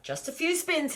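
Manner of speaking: grandma warning on the roundabout; high grandma voice. Acted. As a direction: play a fussing grandma.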